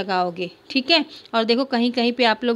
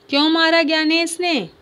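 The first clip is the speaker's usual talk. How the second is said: higher, sharper question; aunt demanding; 6 semitones higher, 3 dB louder than usual. Acted. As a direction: demand an answer from the girl. (बुआ का तीखा सवाल)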